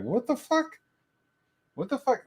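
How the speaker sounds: high, rising what the fuck twice; stunned disbelief. Retold or imitated. Hearing Liam's Boy Scout confession, high stunned disbelief. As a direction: echo your own shock in disbelief.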